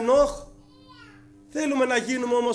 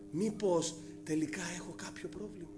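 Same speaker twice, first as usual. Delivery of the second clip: humble inner question; 6 semitones lower, 11 dB quieter than usual. Retold or imitated. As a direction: lower and softer, asking himself. (ήπια, σιγανή αυτοκριτική ερώτηση)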